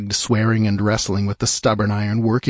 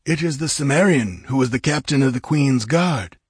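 higher-pitched guttural Zwagir nomad; guttural Zwagir accent; 5 semitones higher than usual. A narrator's reading. Nomad naming the captive to his chief, guttural Zwagir accent. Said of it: "It was the guttural accents of a Zwagir".

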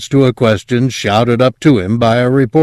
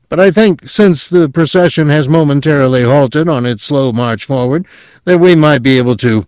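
high, pressing on-air delivery; interrupting commentator; 6 semitones higher, 4 dB louder than usual. A narrator's reading. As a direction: push on with your point, voice raised. Colleague presses on until cut off.

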